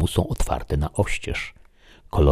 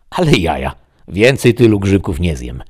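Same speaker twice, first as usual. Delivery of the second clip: a touch of astonishment, louder; amazed exclamation; 3 semitones higher, 5 dB louder than usual. Acted. Man recalling his mushroom dream, amazed. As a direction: exclaim at your own folly.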